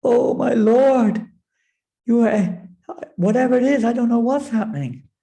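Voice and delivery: high, loud, crying out; tearful plea. Acted. Parvati pleading to Lord Shiva, high and tearful. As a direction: plead through tears.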